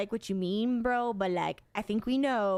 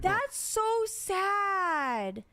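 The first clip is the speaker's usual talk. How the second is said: high, long drawn-out lament; dismayed sympathy; 8 semitones higher, much slower than usual. Real, not acted. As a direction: stretch it out high with pity. Reacting to the screaming woman story.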